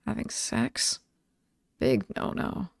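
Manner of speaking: low hushed whisper; low embarrassed whisper; whispered low aside. Acted. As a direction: say it low and hushed like a naughty word. Coy slasher rule aside.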